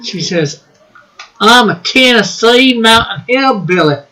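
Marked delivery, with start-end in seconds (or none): 1.4-4.0 a light Tennessee hillbilly twang; lightly voiced Tennessee accent. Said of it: "I don't know what sort of accent that was".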